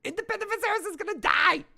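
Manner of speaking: high, strained comic outburst; mock panicked character. Co-host echoing the Viserys parody, mock panic. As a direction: push the voice way up for mock alarm.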